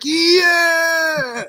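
high, loud ecstatic yell; excited cheer. Retold or imitated. Friend voicing thrilled hospital staff, whooping loud cheer. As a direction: cheer like the thrilled people in the picture. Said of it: "They're like".

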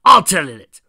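sudden, very loud indignant shout; explosive, irritated outburst; angry yell. Acted. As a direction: cut in with a furious yell. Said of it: "Knuckles glared and yelled".

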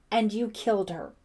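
accusing. Acted. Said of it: "Ellie interrupted".

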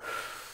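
slow audible exhale; long soft calming sigh; calming breath out. Shown as a demonstration. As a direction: show the kids how to breathe out. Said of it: "Breathe in through your nose".

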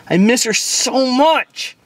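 high drawn-out wail. Acted. Wails the repeat.

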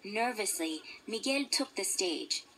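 ordinary delivery, nothing marked

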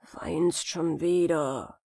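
leise, langsam murrender Alter; mürrisch vor sich hin brummen. Acted. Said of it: "murrte der Alte". (ein Hauch von murrendem Vater)

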